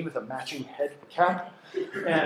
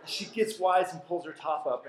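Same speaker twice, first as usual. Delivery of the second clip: normal talking voice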